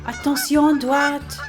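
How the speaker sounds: high, loud honking goose in a hurry; a rude goose barking at traffic, loud and high. A narrator's reading. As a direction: snap it out like a pushy goose. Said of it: "says a particularly rude goose in a hurry".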